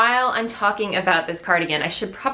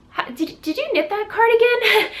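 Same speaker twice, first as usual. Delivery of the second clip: high, excited, gushing rush; eager blurted question; 3 dB louder than usual. Retold or imitated. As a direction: blurt it out, thrilled to spot a fellow knitter. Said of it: "I just said".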